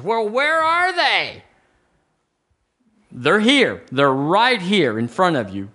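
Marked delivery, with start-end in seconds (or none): 0.0-1.4 high, long-drawn-out rhetorical wail; mock questioning. Preacher voicing a doubter looking for blessings, mock questioning.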